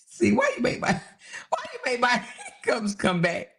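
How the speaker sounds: high-pitched, through real laughter; laughing complaint. Real, not acted. Host blames viewer for returning hiccups, laughing. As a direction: protest helplessly while laughing.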